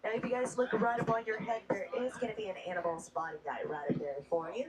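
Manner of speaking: upbeat tour guide patter over the music; brisk, cheerful safety spiel for the riders; hushed excited safari guide. Acted.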